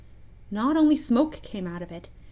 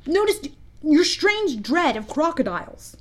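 higher, louder character voice; Smee the pirate; 6 semitones higher, 3 dB louder than usual. A narrator's reading. Smee reminding his captain about his weapon, in a high pirate-sidekick voice. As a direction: pipe up as the loyal sidekick. Smee's voice in story.

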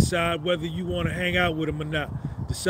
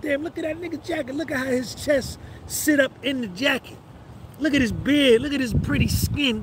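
admiring woman; 5 semitones higher than usual. Retold or imitated. Honey see me, said.

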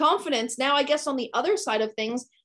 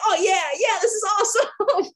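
high-pitched, over-the-top delight; gushing cheer; 10 semitones higher, 3 dB louder than usual. Retold or imitated. Photographer egging on a cuddling couple, gushing. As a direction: gush in a much higher voice.

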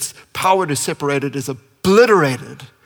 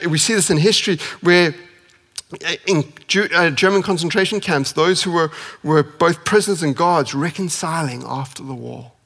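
ordinary talk

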